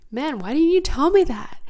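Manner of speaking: acted-out indignant complaint; exasperated complaint. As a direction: call your friends out, pitch swooping up. Voices her complaint to friends.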